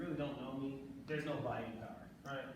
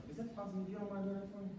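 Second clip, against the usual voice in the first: "possible" asked on a higher, friendly tune; higher, undemanding asking pitch through "for me"; upbeat asking tonality; 5 semitones higher, faster than usual. Shown as a demonstration. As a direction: lift "don't mind" into a positive request. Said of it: "Tonality, one of my other courses".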